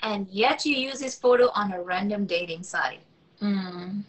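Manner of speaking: put-on high, girlish voice for Rachel; young woman's voice. Young woman covering a spill on the phone, high and flustered. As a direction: play the flustered young woman, pitched well up.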